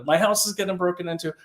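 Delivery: high-pitched, hammed-up emergency plea; mock alarmed caller. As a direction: insist on help like a pretend frantic caller. Acts out a desperate 911 caller.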